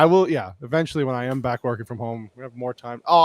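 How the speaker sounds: ordinary talk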